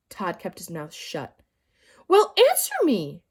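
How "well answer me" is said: much higher, loud demand. A narrator's reading. Teacher demanding Todd answer. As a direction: press him hard for an answer. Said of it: "she demanded".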